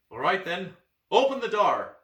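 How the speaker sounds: commanding shout. Acted. Boy hero shouts at the child to open the door, loud. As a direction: order the door opened, loud.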